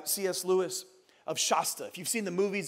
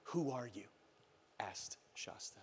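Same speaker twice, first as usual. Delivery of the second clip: very low, near-whispered question; hushed awe; 8 semitones lower, 15 dB quieter than usual. A narrator's reading. Boy asking the lion who he is, hushed. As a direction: let it sink almost to a whisper.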